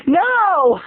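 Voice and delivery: high, panting mock whine; imitating Laura. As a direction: whine it high between gasps. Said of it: "Have you been jogging?".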